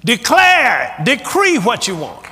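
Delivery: loud, high proclaiming voice; booming command. Retold or imitated. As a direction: declare it like a preacher. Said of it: "Here's what he said".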